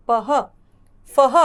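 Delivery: पह and फह called out with a breathy ह; clear demo. Shown as a demonstration. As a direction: model each syllable apart. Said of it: "फह और ब के साथ अह की मात्रा बह". (पह, फह अलग-अलग साफ़ बोलना)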